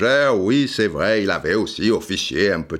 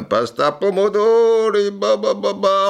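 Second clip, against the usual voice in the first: mock Italian; 11 semitones higher, 4 dB louder than usual. Mock Italian pasta evening.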